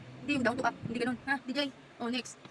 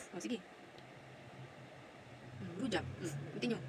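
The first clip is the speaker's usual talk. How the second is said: normal talking voice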